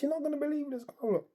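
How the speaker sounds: high-pitched mock calling; retold excited insistence. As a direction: coax them over, pitched well up. Acted reply, calling others over.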